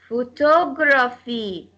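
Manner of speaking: slow "photography", TO stressed. Shown as a demonstration. Teacher demonstrates the rule, TO stressed in "photography". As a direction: draw out the TO in "photography". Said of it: "takes the stress".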